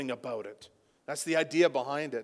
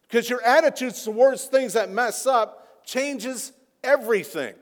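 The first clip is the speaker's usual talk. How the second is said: higher and louder, driving each point home; fiery preaching; 8 semitones higher, 8 dB louder than usual. Acted. Pastor rebuking his congregation, preaching with force.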